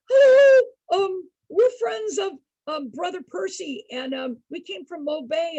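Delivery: high, loud, shaky nervous greeting that fades; nervous hello. Retold or imitated. Travelers greeting a towering stranger at his door, nervous and loud. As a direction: squeak out a scared hello to a giant.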